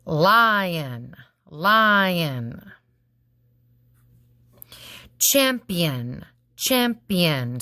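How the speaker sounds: valuable spoken slowly, syllable by syllable. Shown as a demonstration. Teacher to class, sounding valuable out in syllables. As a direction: break valuable into slow even beats. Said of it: "You ready?".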